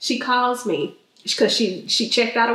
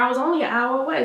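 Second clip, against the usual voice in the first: usual speaking voice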